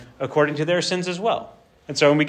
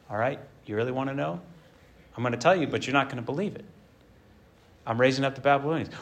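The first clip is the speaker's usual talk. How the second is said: lower, much softer voice of God; a lightly lowered, quiet divine voice; God's reply; 3 semitones lower, 7 dB quieter, faster than usual. Acted. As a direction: lower and hushed, knowing. God answers the prophet.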